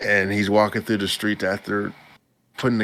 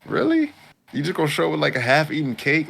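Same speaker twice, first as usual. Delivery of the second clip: incredulous; 7 semitones higher, faster than usual. Retold or imitated. Mocking the girl's half-eaten cake, very high and incredulous. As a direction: squeak out the disbelief, then ease down. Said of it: "And I'm like".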